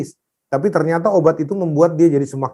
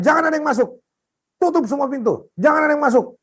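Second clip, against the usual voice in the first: high, urgent command; pitched-up order without extra volume; retold yelled order; 12 semitones higher, faster than usual. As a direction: re-enact barring everyone from the room. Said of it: "apa teriak-teriak".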